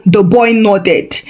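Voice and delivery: ordinary delivery, nothing marked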